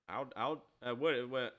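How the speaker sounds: lightly voiced nervous halfling, halting and stammering; uncomfortable halfling. Halfling summoned before a dragon, mildly uncomfortable. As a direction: stumble over the first words, unsure. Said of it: "he's clearly, like, very uncomfortable".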